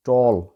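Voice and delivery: टॉल with a rounded "aw", tawl; corrected pronunciation. Shown as a demonstration. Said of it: "गलत है".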